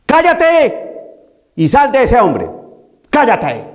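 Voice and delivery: slow, high, commanding rebuke; stern command. Retold or imitated. Jesus rebukes the unclean spirit, slow commanding order. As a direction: order the spirit out with full authority. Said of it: "No entró a decir".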